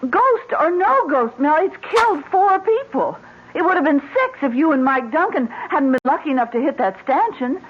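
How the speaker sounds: high, urgent fear for him; frightened pleading. Acted. Wife begging husband not to risk the haunted road, high frightened plea. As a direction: plead as if he's already on that road.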